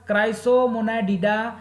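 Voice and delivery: Chrysomonadida drawn out slowly, part by part; careful pronunciation. Shown as a demonstration. Teacher showing students how to say an order name, careful pronunciation. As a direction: say Chrysomonadida slowly and distinctly for learners. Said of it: "pronunciation पे ध्यान दीजिएगा".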